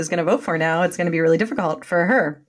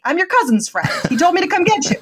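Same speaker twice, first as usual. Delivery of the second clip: lightly imitated New York accent, higher pitch; 8 semitones higher than usual. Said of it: "he had a New York accent".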